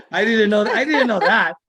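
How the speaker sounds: amused, laughing surprise. Real, not acted. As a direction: say it through real laughter. Laughing reply to Yiddish proverb.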